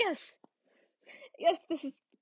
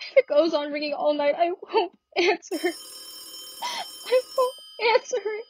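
tearful refusal; 4 semitones higher than usual. Acted. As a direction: sob out a stubborn refusal. Crying refusal.

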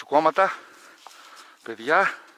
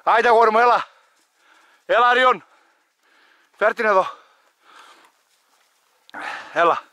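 loud, higher coaxing call; calling the dog; 7 semitones higher, 10 dB louder than usual. Real, not acted. Hunter loudly coaxing his dog to bring the bird back.

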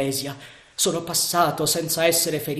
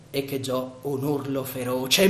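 ordinary delivery

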